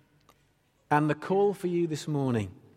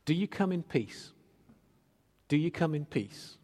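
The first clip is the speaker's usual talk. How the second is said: quiet repetition; 7 dB quieter than usual. Real, not acted. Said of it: "That was the big question".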